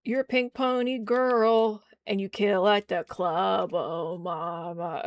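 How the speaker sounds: high, loud and drawn out, mocking; mock high diva voice. Streamer mocking the character in a high, slow voice. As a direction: camp it up in a slow sing-song.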